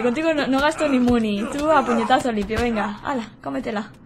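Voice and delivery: ordinary delivery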